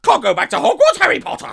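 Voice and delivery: squeaky high Dobby shout, laughing; Dobby impression. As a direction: shriek it like a house elf, cracking up. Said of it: "That was horrible".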